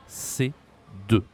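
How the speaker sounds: "C" and "deux." as separate beats; modelled French drill; slow syllable-split model. Shown as a demonstration. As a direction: break "C deux." into two clear words. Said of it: "on dit".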